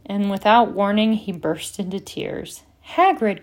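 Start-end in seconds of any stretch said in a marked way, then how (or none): 2.9-3.4 high, loud cry of a name. A narrator's reading. Hermione seeing Hagrid burst into tears, crying out. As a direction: cry out to him in alarm. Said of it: "cried Hermione".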